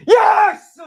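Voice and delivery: high, loud cheer; ecstatic yes. Real, not acted. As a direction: shout it as the goal is given. Goal confirmed, cheering.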